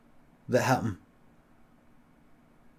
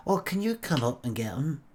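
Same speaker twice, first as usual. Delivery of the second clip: softer, lightly put-on Blackpool woman's voice; Blackpool accent; 5 dB quieter than usual. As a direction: ask softly with a hint of a Blackpool accent. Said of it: "is that a Blackpool accent you've got".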